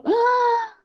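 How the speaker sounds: high, drawn-out mock gasp; surprised gasp. Speaker catching her own fear by surprise, mock surprised gasp. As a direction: gasp as if catching someone red-handed. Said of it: "de surpresa, eu falo".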